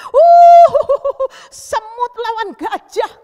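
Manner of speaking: loud, high whoop, then drawn-out taunt; Goliath's jeering. Acted. Giant seeing small David, loud mocking jeer. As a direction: scoff hugely at a tiny challenger. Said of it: "si Goliat ketawa".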